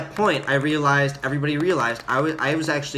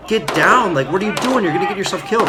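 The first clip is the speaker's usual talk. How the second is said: raised, higher retold warning; urgent warning; 5 semitones higher, 4 dB louder than usual. Squad to marine standing under fire, mild retold urgent shout. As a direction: mimic a squad calling out in alarm.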